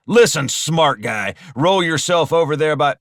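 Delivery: irritated, forceful robber; gruff ordering. Acted. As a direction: order the smart guy around. Carl orders Alden about threateningly.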